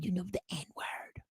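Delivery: put-on hushed, slow whisper in country accent; confiding whisper. Bar regular avoiding being overheard by liberals, whispering. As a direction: drop to a secretive whisper.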